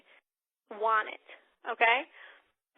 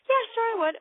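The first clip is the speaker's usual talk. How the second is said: guest voice; 11 semitones higher, 7 dB louder than usual. Retold or imitated. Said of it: "guest answers".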